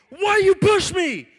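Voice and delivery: high, drawn-out angry bellow; confrontational shout. Retold or imitated. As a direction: bellow the accusation, slow and high. Angry stranger confronts him.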